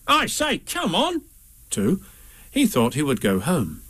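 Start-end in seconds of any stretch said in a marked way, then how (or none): 0.1-1.2 much higher, bouncy Tigger voice; Tigger calling. A narrator's reading. Tigger popping out of the mist, high excited call. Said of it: "having nobody to say".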